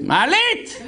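high mock call into the air; hammed-up high shout; mock yell. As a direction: shout for the elevator like an old grandmother. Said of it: "אז היא צועקת".

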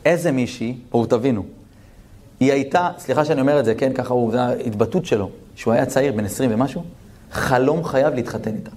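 usual speaking voice

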